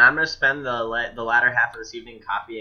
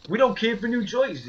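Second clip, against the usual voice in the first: put-on Brooklyn accent; 11 semitones higher than usual. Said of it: "thick Brooklyn accent".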